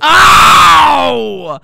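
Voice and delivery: loud, high cry of pain; pained yell. Acted. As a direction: yelp as if falling. Pushed off a cliff.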